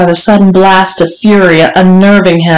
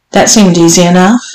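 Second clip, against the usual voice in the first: usual speaking voice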